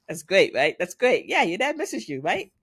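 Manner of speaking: high-pitched, over-keen imitation of a mom; overeager gushing. Mom trying too hard to smooth things over, overeager. Mom overdoing a cheerful claim.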